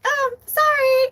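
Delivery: very high, tossed-off mock apology; flippant sorry. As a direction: chirp it like you don't mean it. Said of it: "It's not a flippant".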